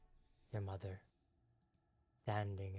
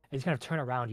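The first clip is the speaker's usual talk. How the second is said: normal talking voice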